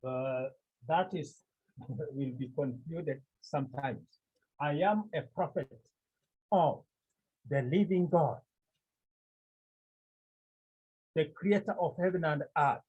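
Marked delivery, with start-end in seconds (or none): 4.6-12.8 firm declaration of who you are; assertive. Acted. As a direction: state it emphatically, leaning on the key words. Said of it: "So he is asserting".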